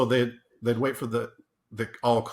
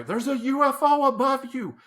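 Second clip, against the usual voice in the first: much higher, mock-alarmed old lady; old lady caller; 14 semitones higher, 4 dB louder than usual. Old lady calling nine one one about a UFO, mock-alarmed high voice. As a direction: squeak it out like a panicked old lady on the phone. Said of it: "usually old ladies".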